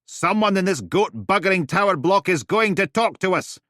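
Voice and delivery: loud, high bellow down the block. Acted. Steele bellowing as she storms off. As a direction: bellow it for the whole building. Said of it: "bellowing it out".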